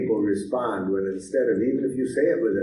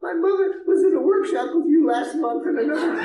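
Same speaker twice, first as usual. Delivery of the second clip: woman answering; 5 dB louder than usual. Retold or imitated. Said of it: "I said to her".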